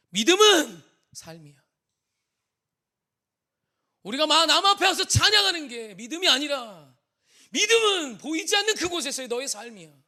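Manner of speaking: loud, high, crying out to students; tearful teacher's plea. Retold or imitated. Teacher crying out to his worship team, loud tearful rebuke. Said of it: "막 우는 거예요".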